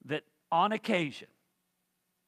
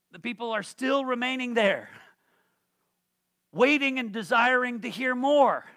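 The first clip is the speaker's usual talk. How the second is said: loud, high, impassioned preaching; fervent preaching; 7 semitones higher, 7 dB louder than usual. Acted. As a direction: preach it with fire. Preaching at full force.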